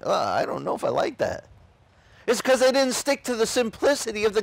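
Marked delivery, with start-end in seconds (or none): 0.0-1.4 1 mock recoil.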